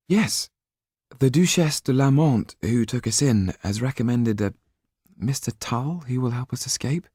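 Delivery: put-on a young noblewoman explaining, a little anxious; young woman. A narrator's reading. Sido's voice held by narrator.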